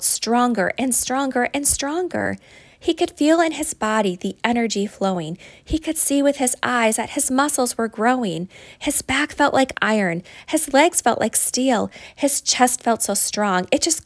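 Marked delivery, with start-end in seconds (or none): none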